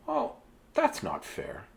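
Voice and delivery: plain reading voice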